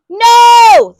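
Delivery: high, very loud shout; father shouting at the cashier; loud yell. A narrator's reading. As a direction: yell it back. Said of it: "The father yelled".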